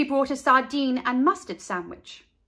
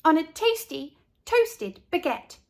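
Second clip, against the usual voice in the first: put-on proud girl; 7 semitones higher than usual. A narrator's reading. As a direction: present it like a treat. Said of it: "said Carla".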